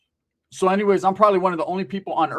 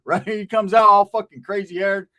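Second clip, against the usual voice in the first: usual speaking voice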